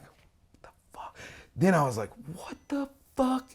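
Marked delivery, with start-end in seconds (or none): 0.0-1.2 slow, breathless whisper of disbelief; stunned whisper. Retold or imitated. Horrified reaction to attic. 2.3-3.5 much higher, slow, baffled; can't believe your eyes; shocked disbelief. Retold or imitated. Horrified reaction to attic.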